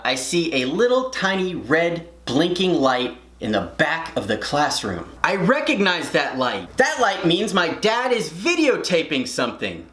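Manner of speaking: normal talking voice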